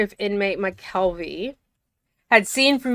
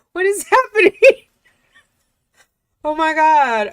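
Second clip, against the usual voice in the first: much higher, louder stunned question; shocked disbelief; 8 semitones higher, 7 dB louder than usual. Real, not acted. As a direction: gasp it out in real disbelief. Reacting to shocking log entry.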